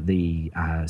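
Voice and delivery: usual speaking voice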